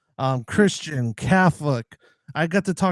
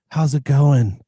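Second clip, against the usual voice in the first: louder, brighter Aussie greeting, lightly imitated; a friendly Aussie "no worries, mate"; mock Aussie cheerfulness; Australian accent; 6 dB louder than usual. Americans contrasted with Australians.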